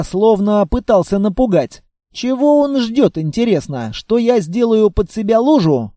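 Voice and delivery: usual speaking voice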